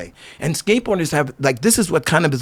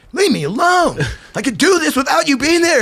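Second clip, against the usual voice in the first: high-pitched mock outburst; exasperated protest; 10 semitones higher than usual. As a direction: push back like a fed-up rider. Retold protest at being corrected.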